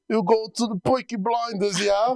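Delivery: high, hammed-up British accent; bad Peaky Blinders accent. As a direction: do a badly faked British gangster accent. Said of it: "what accent he does".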